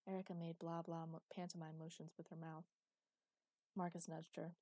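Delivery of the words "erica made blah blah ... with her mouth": usual speaking voice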